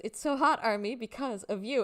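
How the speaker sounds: a light imitation of a performer addressing fans. Fan retelling one bandmate teasing another at dinner, lightly imitated. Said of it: "this perfect impression of [name]".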